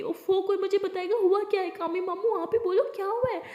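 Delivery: high, loud exasperated demand. Acted. Aliya demands her uncle explain what happened, exasperated and loud. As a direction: lose patience and demand answers.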